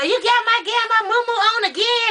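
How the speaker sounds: high, put-out complaint; retelling scolding a boyfriend; mock scolding. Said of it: "And I'd be like".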